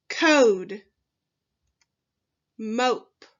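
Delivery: teacher reading a phonics list, slow and emphatic; word list read aloud. Shown as a demonstration. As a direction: model every word clearly for the class to echo. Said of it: "Read the words aloud in the box with me".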